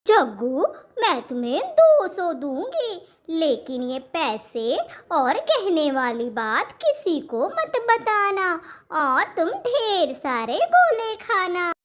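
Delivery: put-on a lilting mom giving in to her child; indulgent mother. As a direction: coax the boy with swooping pitch. Mother answers her son.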